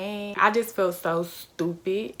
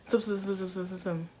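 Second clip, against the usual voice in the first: mock indistinct mumble; mumbling imitation; 5 dB quieter than usual. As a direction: run the syllables together like muffled talk. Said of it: "he was talking towards the T V".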